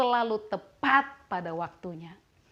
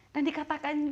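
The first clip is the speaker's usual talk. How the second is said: ordinary delivery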